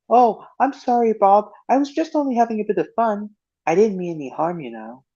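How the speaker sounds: high, cheeky scarecrow voice, sheepish; Spud apologizing. Retold or imitated. Spud says sorry to Bob, high and sheepish. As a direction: squeak out a guilty apology. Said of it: "where Spud gets scolded by Bob".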